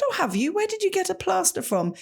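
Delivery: high-pitched, lightly voiced teacher question; surprised teacher. Teacher questioning a little pupil, lightly acted surprise. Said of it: "And she said".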